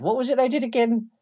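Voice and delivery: high, quick mock-curious question; mock innocent question. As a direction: pop it up high and fast. Tim asks guards.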